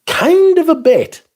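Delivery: high, slow, strained squeak of discomfort; squeaky falsetto. Real, not acted. Streamer squeaking at a creepy viewer comment, high falsetto.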